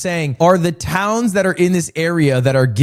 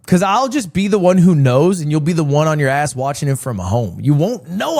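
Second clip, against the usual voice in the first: usual speaking voice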